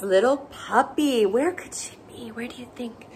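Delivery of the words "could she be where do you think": whispered, secretive; whispered question. Acted. Host asking little listeners where the puppy hides, whispered.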